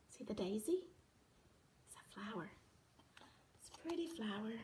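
soft low whisper; hushed whisper to the child. Acted. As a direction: whisper it gently. Soft whisper while reading.

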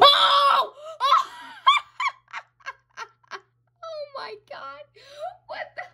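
very high shrieking laugh; shocked laughter. Real, not acted. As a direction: burst into real, squealing laughter. Pulls dream card, shrieks with laughter.

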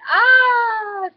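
high, loud "ah" scream; storybook scream. A narrator's reading. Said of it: "Sam screamed".

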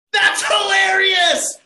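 high, loud, slow laughing exclaim; laughing shout. Acted. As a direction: burst out laughing as you say it. Agatha finally laughs.

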